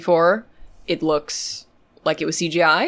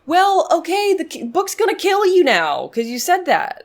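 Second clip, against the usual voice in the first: higher, teasing mock reply; 11 semitones higher than usual. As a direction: squeak it out teasingly.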